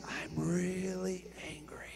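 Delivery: whisper. Shown as a demonstration. Preacher shows saying I'm really angry, whispered. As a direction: whisper the anger calmly. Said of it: "volume down".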